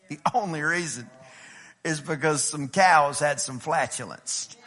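low, scoffing mock claim. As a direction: barely keep a straight face. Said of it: "I can't even say that with a straight face".

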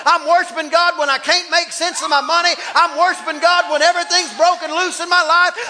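high, loud pulpit shouting; impassioned, relentless sermon climax; full-force preaching. Real, not acted. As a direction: hammer out each declaration in a rising chant-like cadence.

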